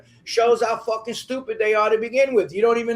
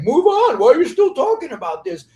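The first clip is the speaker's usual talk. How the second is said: high, loud, rushed mock whine of the other side; mocking dismissive quote; 5 semitones higher, 5 dB louder than usual. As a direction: sneer it out like an annoyed critic brushing you off. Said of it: "so they're just like".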